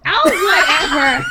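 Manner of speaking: laughing shout. Real, not acted. Laughing at predicted start.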